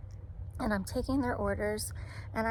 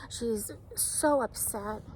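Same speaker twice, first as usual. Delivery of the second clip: ordinary delivery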